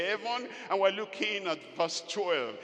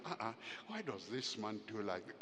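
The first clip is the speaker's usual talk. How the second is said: muttered complaint; 8 semitones lower, 12 dB quieter, faster than usual. Retold or imitated. Wife's disappointed complaint.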